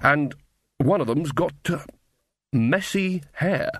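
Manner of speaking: halting, with drawn-out final words; wilting, hesitant. Acted. Corporal wilting under Granny's stare, slow and hesitant. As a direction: stall before the awkward bit. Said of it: "wilting under Granny's stare".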